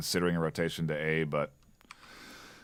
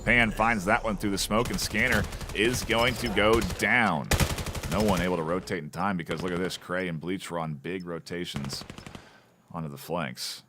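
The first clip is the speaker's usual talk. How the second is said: fast, rising play-by-play call; esports caster; 2 semitones higher, 4 dB louder than usual. Real, not acted. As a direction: call the action as it happens. Live play-by-play of a match.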